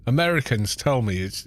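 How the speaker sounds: ordinary talk, nothing marked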